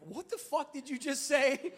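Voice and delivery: high, loud incredulous outburst; shocked disbelief. Retold or imitated. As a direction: blurt it out, stunned. Shocked at church news.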